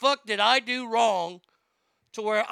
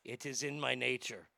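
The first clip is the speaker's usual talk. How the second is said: low, resigned mock French accent; shrugging French scorpion, lower; 5 semitones lower, 3 dB quieter than usual. Scorpion excusing itself to the dying frog, French accent. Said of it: "Scorpion says".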